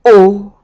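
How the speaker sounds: loud pained cry of dismay. A narrator's reading. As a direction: cry out as if struck. Servant dismayed at being released. (bestürzter Ausruf)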